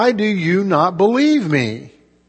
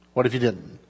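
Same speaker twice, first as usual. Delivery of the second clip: low, much softer rhetorical question; hushed repeat; 8 semitones lower, 11 dB quieter, faster than usual. Real, not acted. Preacher repeats the question to the congregation, hushed and low. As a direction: drop right down and let the question sink in.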